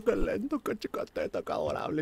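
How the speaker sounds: put-on hushed, breathy baby-talk whisper; tender whispered baby talk; soft cooing. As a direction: coo softly so the baby stays asleep. Cooing over sleepy baby.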